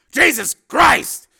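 high, loud, drawn-out shock; slow, shouted astonishment; stunned exclamation. Acted. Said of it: "stunned by the news".

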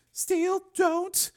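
pleading woman. A narrator's reading. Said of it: "she said".

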